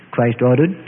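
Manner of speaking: usual speaking voice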